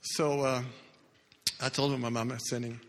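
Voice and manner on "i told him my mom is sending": ordinary talk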